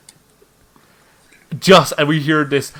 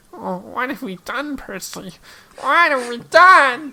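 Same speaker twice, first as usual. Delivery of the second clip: high, aghast monster voice; aghast creature crying out; horrified Swamp Thing; 10 semitones higher, slower than usual. Acted. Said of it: "just looks at you and says".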